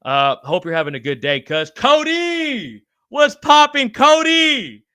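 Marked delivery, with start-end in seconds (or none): 1.7-4.8 excited call-out. Real, not acted. Calling out greeting to viewer.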